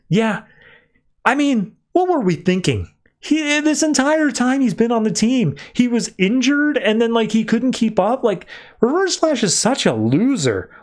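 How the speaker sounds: high-pitched acted badmouthing; mocking disparagement. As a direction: trash an absent teammate in a high mock voice. Mocking absent teammate, mic left on.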